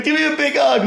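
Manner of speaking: high, gushing mock welcome; retold eager greeting. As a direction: act out begging for a hug, pitched high.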